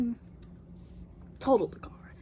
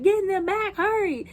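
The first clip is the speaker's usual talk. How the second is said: high-pitched hurried command; acted frantic urgency; imitated urgent order; 12 semitones higher than usual. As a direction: urge her back as the panicked dad. Dad urging daughter during lion attack.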